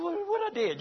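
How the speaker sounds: high-pitched, defensive questioning; mock innocent protest. Husband answering the Holy Spirit's rebuke, mock innocent. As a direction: play the caught-out man, voice jumping up.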